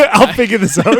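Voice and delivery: high, loud, through laughter; laughing retelling. Retold or imitated. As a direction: barely get the quote out laughing. Retelling scene while laughing.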